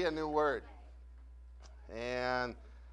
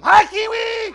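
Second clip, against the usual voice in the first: big high shouted greeting; loud rallying call to the crowd; yell; 17 dB louder, slower than usual. Pretend. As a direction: yell it out to the whole room. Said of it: "Everybody yell at him".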